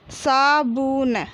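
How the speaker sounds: slow, stretched सा, बु, न, falling at the end; drawn-out teaching reading of साबुन; syllable by syllable. Shown as a demonstration. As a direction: sound out साबुन piece by piece. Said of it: "तो हम इस प्रकार पढ़ेंगे".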